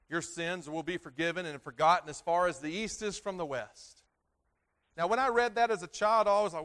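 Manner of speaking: loud, high preaching voice; preaching at full force. Acted. Pastor to congregation, preaching loud and high.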